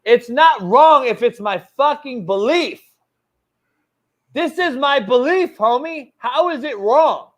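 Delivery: real anger, high and loud; heated outburst. Real, not acted. Host defending his belief to critics, heated and loud.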